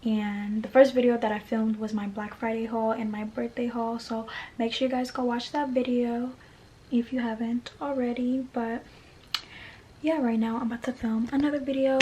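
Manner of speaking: normal talking voice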